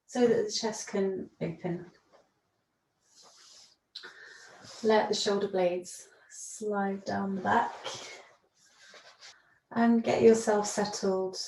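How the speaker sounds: soothing guided relaxation read; guided yoga practice. Acted. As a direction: talk them gently down into rest.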